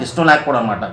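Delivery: normal talking voice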